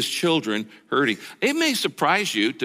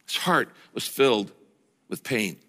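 soft and sorrowful; 6 semitones lower, 4 dB quieter than usual. Acted. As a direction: let "pain" fade away, grieving. Repeats verse slowly, softly.

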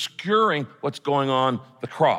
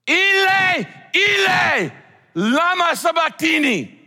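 very high, loud anguished scream; 6 dB louder, slower than usual. Acted. Preacher voicing Jesus screaming to God from the cross, full anguished scream. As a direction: scream it out in agony. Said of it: "literally means he screamed it".

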